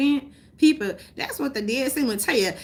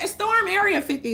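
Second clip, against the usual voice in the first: high, hyped mock voice; mocking the crowd; 11 semitones higher, 3 dB louder than usual. Sheep and zombies hyping an Area 51 raid, high mock voice. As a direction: parody the eager crowd, higher. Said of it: "We're like".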